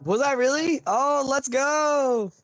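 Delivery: ordinary talk, nothing marked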